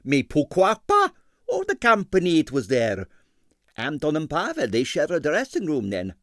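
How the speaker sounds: put-on high, heavily accented excitable impresario; Stroganoff's Russian accent. A narrator's reading. Ballet impresario to the detective, in a Russian accent.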